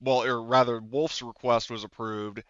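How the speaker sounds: ordinary talk, nothing marked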